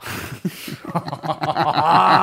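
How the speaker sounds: loud laugh breaking out; real burst of laughter. Real, not acted. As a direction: laugh hard at your own blunder. Laughing at own confession.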